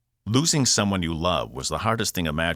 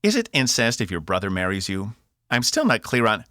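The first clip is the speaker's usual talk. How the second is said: lightly voiced Cockney robot, prattling; Cockney robot, musing; 5 semitones higher, 3 dB louder than usual. Box keeps prattling about marriage to Cass, musing Cockney. Box's held Cockney character voice.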